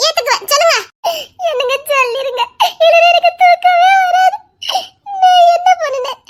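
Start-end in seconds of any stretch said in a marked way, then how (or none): 2.7-6.2 higher, louder, laughing half in tears; laughing plea. Real, not acted. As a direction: plead through giggles that verge on crying. Wife begs, laughing near tears. (சிரிப்பும் அழுகையும் கலந்த கெஞ்சல்)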